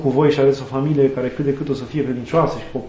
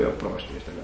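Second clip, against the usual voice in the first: breathy, scornful aside mimicking the critics; mocking with irony; 6 semitones lower, 13 dB quieter than usual. Priest mimics consiliers scorning volunteers, hushed ironic sneer. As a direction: sneer it under your breath like a sly mocker. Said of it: "așa, cu ironie".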